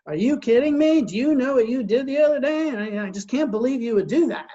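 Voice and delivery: hammed-up indignant accusation; aggressive outburst. Husband confronting his wife in a demo, angry. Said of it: "take more of an aggressive approach and say".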